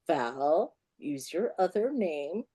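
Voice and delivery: low, soft, slow nudge; discreet prompting. Retold or imitated. Business partner behind her prompts the author, low and discreet. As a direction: prompt someone quietly from behind.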